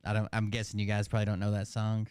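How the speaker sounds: normal talking voice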